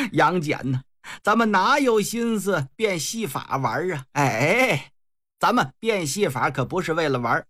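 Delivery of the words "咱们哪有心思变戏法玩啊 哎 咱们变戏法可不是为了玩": ordinary delivery, nothing marked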